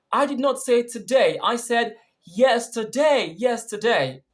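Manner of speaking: high, insistent correction hitting "yesterday"; stress on yesterday. Shown as a demonstration. Correcting someone who heard today, emphatic stress on "yesterday". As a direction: draw out "yesterday", then repeat it firmly. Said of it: "that would have made yesterday stressed".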